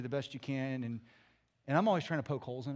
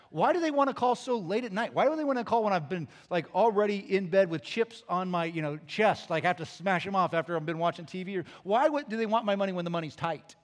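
loud, higher-pitched mock griping; exasperated complaint; 3 semitones higher, 8 dB louder than usual. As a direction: whine loudly about being put upon. Voicing his own exasperated complaint.

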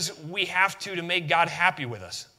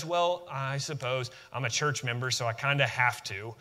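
sighing, half-hearted, lower and softer grudge; reluctant grudging; 6 semitones lower, 6 dB quieter than usual. Retold or imitated. Preacher imitates a reluctant church member, grudging and soft. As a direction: sound like you'd rather not. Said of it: "that shouldn't be our attitude".

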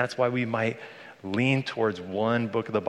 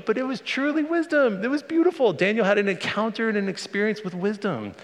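much higher, louder and moved; rising wonder; 12 semitones higher, 4 dB louder than usual. Real, not acted. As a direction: lift into awe at the wisdom. Moved preacher praising the lesson.

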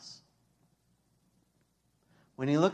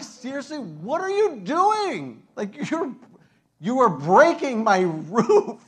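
high, loud exasperated yell at the roof wreckers; mock outraged homeowner; 7 semitones higher, 9 dB louder, slower than usual. Homeowner yelling at men tearing his roof, high indignant shout. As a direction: play the fed-up homeowner shouting up.

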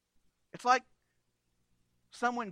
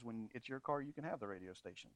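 dad's dry retort; 10 semitones lower, 14 dB quieter than usual. Retold or imitated. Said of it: "My dad's response to that was".

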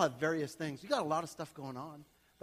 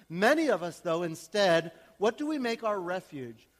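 1 loud preaching; 7 dB louder than usual. Acted.

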